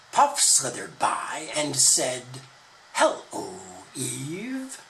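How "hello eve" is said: an altered, sly serpent greeting; lightly voiced snake. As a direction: greet her like a tempting snake. Snake character greets Eve.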